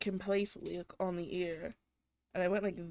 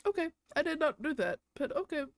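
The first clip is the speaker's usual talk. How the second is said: much higher, incredulous retort; stunned disbelief; 8 semitones higher than usual. Retold or imitated. Retelling reply to boyfriend who accused her, incredulous. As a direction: pitch up in hurt disbelief.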